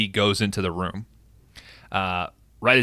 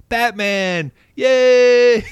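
high, loud cheer with a long-held yay; imitated yell; 8 dB louder, much slower than usual. Mimicking the little guy cheering for Batman, high drawn-out yell. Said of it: "little guy who yells".

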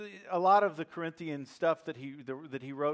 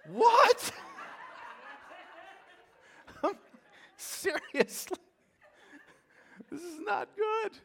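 high, laughing squeal of disbelief; 3 dB louder, slower than usual. Real, not acted. Preacher laughing in mock shock at the hairdresser. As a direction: laugh through the outrage, pitch way up.

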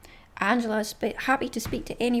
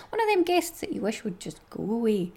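ordinary delivery

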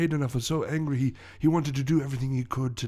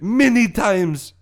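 put-on high, loud, drawn-out French-accented cry; slow melodramatic wail in French accent; anguished outburst; 8 semitones higher, 6 dB louder, slower than usual. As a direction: hammer it home as a wronged Frenchman. Villain stresses the beatings.

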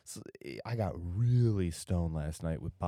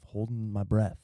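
ordinary talk

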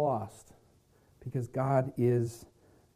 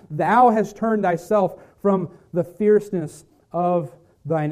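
scripture reading; 5 dB louder than usual. Acted. Reads Psalm 85 aloud.

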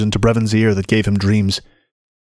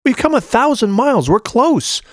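put-on much higher urging girl; 13 semitones higher than usual. A narrator's reading. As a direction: push him on, pitched high. Shai urging Brevin on.

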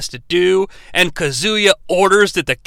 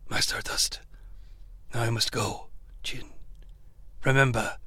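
low, soft, hushed mock-tender farewell; hushed overly tender father figure; tender mentor voice; 8 semitones lower, 14 dB quieter than usual. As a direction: ham up a sappy heartfelt goodbye. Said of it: "share a very tender moment".

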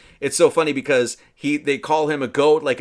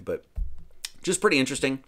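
normal talking voice

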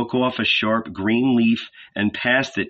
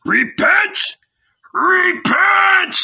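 much higher, louder desperate yell; louder wild shout; 5 dB louder, much slower than usual. Acted. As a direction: bellow it over the crowd. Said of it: "And then louder".

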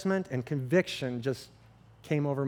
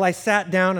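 usual speaking voice

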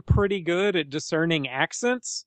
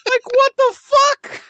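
incredulous squeal; 7 dB louder than usual. Real, not acted. Laughing at the officer's offer, high incredulous squeal. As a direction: stretch the disbelief way up. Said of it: "reacting to the officer".

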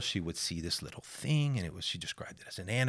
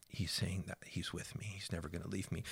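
put-on soft whisper of a child's reply; whispered answer; 12 dB quieter than usual. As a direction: whisper it as a shy, sincere girl. Quoting girl's quiet answer.

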